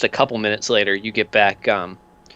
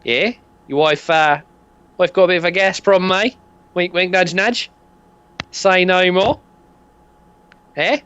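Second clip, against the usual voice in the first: higher, fast and nudging; sly dealer; 7 semitones higher than usual. Acted. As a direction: wink wink, push it knowingly. Dealer winking at player.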